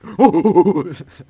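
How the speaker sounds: loud, high whooping gasps; excited hooting gasps. Retold or imitated. Streamer re-enacting his reaction to a pull on a train, loud excited gasps. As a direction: gasp and hoot loudly in excitement. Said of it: "I was like".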